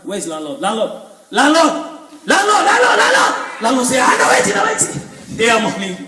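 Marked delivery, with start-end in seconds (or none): 0.6-3.6 calls rising higher each time; calling out. Retold or imitated. Woman searching for landlord, calling out higher and higher. 4.0-4.8 squeaky high hurried answer; high eager reply. Retold or imitated.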